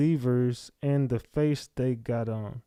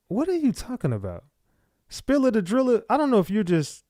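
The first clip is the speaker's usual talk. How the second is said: baffled; 8 semitones higher than usual. Real, not acted. Baffled by a chat comment.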